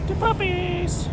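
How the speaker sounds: high, drawn-out squeal to the dogs; excited pet call. Real, not acted. Driver greeting the puppies, excited high-pitched call. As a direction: stretch it out way up high.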